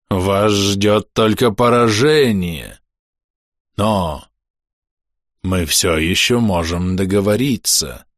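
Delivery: slow, high, unnerving drawled menace; creepy mind voice. Acted. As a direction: seep into their minds, slowly. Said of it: "голос, проникал и въедался в мозг".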